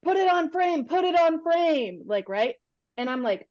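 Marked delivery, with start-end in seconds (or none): 0.0-2.0 high, loud mock yell from the sideline; retold coach screaming at his team; imitated coach's scream. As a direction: shout it like the opposing coach barking orders. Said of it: "the other team's coach was screaming".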